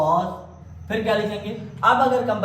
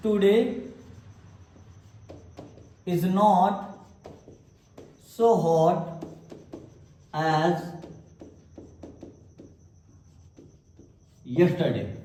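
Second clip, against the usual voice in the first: slow dictation; much slower than usual. Shown as a demonstration. Teacher dictating example sentence.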